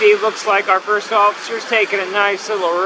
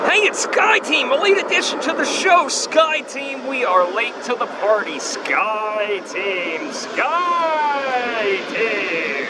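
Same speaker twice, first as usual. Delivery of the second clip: high-pitched cheer settling into long sliding calls; playful high singsong cheer; mock fanfare; 7 semitones higher, much slower than usual. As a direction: hail it like a hammed-up announcement, drawing out the last calls. Mock fanfare for late arriving plane.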